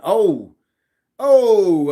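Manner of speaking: sudden high, loud "oh"; surprised outburst. Real, not acted. Streamer reacting to a user getting timed out, loud surprised cry. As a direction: yelp in surprise at the news.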